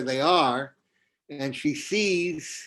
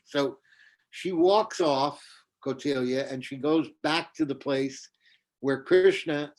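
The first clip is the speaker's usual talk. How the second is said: plain reading voice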